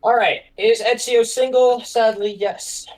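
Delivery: usual speaking voice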